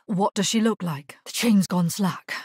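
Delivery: normal talking voice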